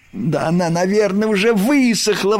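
high, eager outburst; excited exclamation. A narrator's reading. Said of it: "воскликнул Крош".

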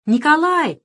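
loud high call; shout. A narrator's reading. Boy calls after departing Nikolai, loud. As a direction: call after him down the road. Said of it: "кричал он".

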